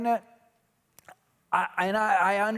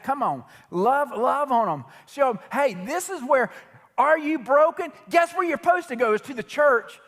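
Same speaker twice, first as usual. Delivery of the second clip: full-force preaching; 4 semitones higher than usual. Real, not acted. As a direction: preach it like a revival, rising on each question.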